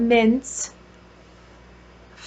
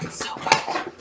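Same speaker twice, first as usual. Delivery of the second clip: breathy whispered so good; 6 dB quieter than usual. Real, not acted. Sniffing a wax melt, whispering her delight to viewers.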